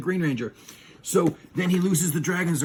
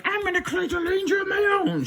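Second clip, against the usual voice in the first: high squealing villainess voice; Rita. Acted. Villain plotting her own ranger, high cartoon voice. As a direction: scheme aloud in a very high cartoon voice.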